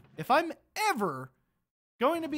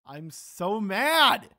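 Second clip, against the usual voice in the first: louder, slow drawn-out fury; frustrated outburst; 6 dB louder, much slower than usual. Real, not acted. Gamer yelling at viewers over lost sword, slow and loud. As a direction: let it burst out, stretching each word.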